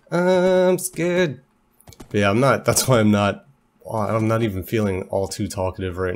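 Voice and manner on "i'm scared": mock scared. Fleeing a mob in game.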